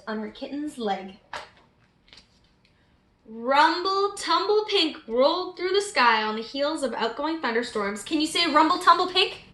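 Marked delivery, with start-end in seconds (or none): none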